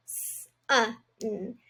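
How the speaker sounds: S-uh-n, sound by sound; sounding out sun. Shown as a demonstration. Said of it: "start with the first syllable".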